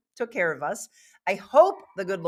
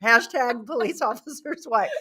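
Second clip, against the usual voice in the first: laughing; 4 semitones higher than usual. Real, not acted. Laughing at own joke.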